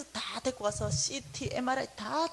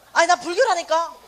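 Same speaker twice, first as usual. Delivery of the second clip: put-on higher, much louder fed-up old woman; exasperated retort; 6 semitones higher, 11 dB louder than usual. Roommate exasperated by the same question, higher and louder. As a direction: throw it back, fed up.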